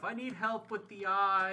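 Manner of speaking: high, drawn-out mock kid voice; child calling out. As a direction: call it out like a needy little kid. Acted child calling to dad.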